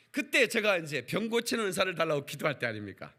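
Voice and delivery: ordinary talk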